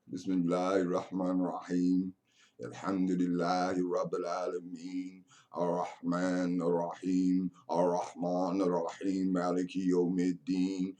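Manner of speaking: soft, low chanted recitation; murmured Arabic Fatiha recitation; Quran recitation. Acted. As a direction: recite it quietly as in prayer. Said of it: "That's what you're saying in the mosque".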